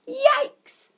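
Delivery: very high, loud yelp of alarm; startled yelp. A narrator's reading. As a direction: yelp as if suddenly frightened. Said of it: "said Bertie".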